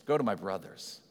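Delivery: quiet, tender voicing of Jesus; tender quote. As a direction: say it like a loving brother.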